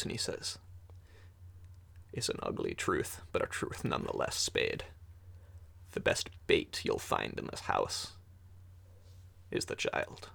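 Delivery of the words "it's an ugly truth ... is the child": low, hushed and secretive, close to the ear; low hushed warning. Acted. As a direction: lean in and breathe the warning. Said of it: "pulls you close".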